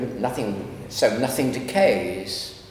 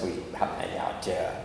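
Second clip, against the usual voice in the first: quoting Paul; 6 semitones lower, 8 dB quieter, faster than usual. Retold or imitated. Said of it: "And Saint Paul himself says".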